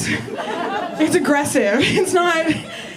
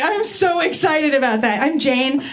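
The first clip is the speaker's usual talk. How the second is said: usual speaking voice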